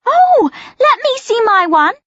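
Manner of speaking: put-on high, eager girly cry; snatch at it, eager and loud; excited cry. A narrator's reading. Megan grabbing the camera, excited high cry. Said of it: "Megan cries".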